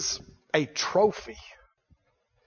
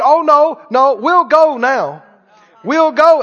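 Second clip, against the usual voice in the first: louder, higher mock backpedalling; insistent protest; 6 semitones higher, 8 dB louder, slower than usual. Israelites to God after being sent back, insistent protest. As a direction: protest loudly as the people changing their minds.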